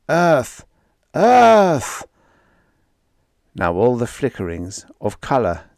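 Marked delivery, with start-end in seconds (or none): 0.1-2.1 loud desperate cry. A narrator's reading. Brunet crying out.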